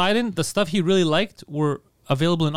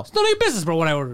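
indignant; faster than usual. Real, not acted. Diner telling friend to drop the question, indignant. Heated argument over pork belly order.

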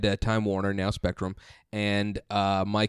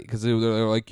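ordinary talk, nothing marked

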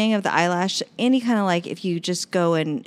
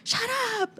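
put-on much higher, bratty child's retort; mock bratty kid. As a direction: snap it like a sulky kid. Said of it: "it's just like".

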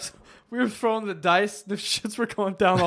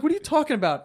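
high-pitched disbelieving question; retold incredulity; 8 semitones higher, faster than usual. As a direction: act out baffled disbelief. Retold reaction to not showering.

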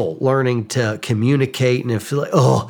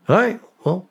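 mock breezy goodbye; 9 semitones higher, faster than usual. Dismissing wife's movie refusal.

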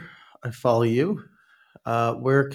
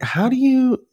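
exasperated disbelief; 12 semitones higher than usual. Retold or imitated. Exasperated quote of himself.

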